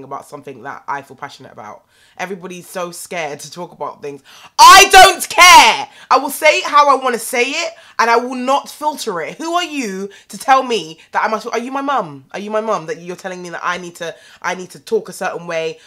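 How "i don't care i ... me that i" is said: shouting, much higher and louder, then easing down; defiant shout. Real, not acted. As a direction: yell it back at the haters, refusing to back down. Defiant outburst at commenters.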